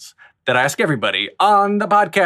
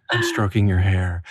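lower, intimate mock-romantic coo; mock relationship ASMR; 8 semitones lower than usual. Host parodying relationship ASMR to guest, low and cooing. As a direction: croon it low like a pretend boyfriend. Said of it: "the relationship A S M R".

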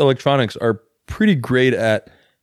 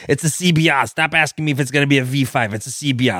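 a hint of a Long Island old lady; lightly put-on Long Island old lady; Long Island accent. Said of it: "what accent is that?".

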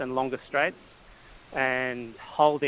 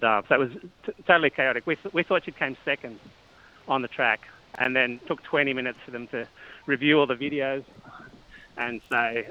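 normal talking voice

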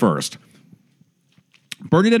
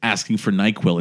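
usual speaking voice

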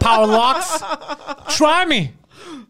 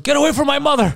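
higher-pitched mock shout of outraged boys; mock protective yell; 6 semitones higher, faster than usual. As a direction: yell it like kids guarding their mother.

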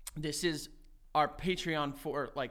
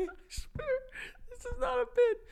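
laughing. Real, not acted. Protesting through laughter.

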